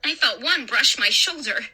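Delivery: usual speaking voice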